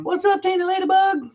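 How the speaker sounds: very high, squeaky voice; squeaky high greeting. Pretend. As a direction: squeak it out playfully.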